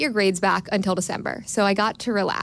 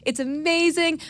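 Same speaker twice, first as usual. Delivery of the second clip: quoted doctor, much higher and bright with joy; thrilled doctor; 9 semitones higher than usual. Retold or imitated. Doctor telling mother and daughter the treatment works, delighted. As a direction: beam out the good news. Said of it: "She says".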